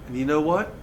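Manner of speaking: usual speaking voice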